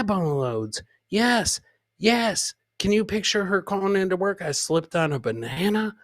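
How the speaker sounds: normal talking voice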